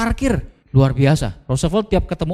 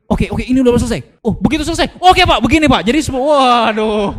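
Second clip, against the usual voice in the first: eager jump-in; 6 semitones higher than usual. Retold or imitated. Retells eagerly jumping in.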